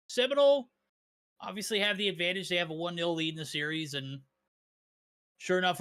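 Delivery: usual speaking voice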